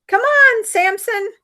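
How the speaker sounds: yelling Yeehaw. Acted. Margot yelling up to Samson, high whooping call. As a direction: holler to your friend from the bottom. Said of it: "as she's yelling".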